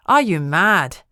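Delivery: shouting. A narrator's reading. Jack shouting at Kate after her reckless search. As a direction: blow up at her. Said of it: "he shouted".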